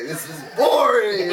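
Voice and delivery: loud, high bored outburst; yelling. Retold or imitated. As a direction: imitate someone hollering in boredom. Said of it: "like, yelling".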